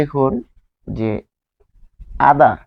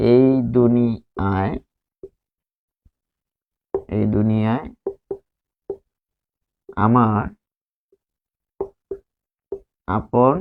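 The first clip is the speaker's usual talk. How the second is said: slow dictation; 3 semitones lower, slower than usual. Shown as a demonstration. Teacher dictating to the class, slow and deliberate. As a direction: dictate the sentence slowly, word by word. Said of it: "যখন আমরা বানান লিখব".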